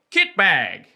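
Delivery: "Kit bag" pushed out the right nostril. Shown as a demonstration. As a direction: say "Kit bag" through the nose, falling. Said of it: "Again, out the right nostril".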